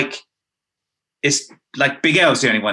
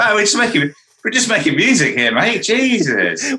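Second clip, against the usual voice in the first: mock offended; 8 semitones higher than usual. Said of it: "mock reaction to aggressive verse".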